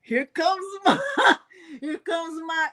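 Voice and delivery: playful Irish accent attempt; lilting Irish accent, laughing. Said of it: "is it Irish accent?".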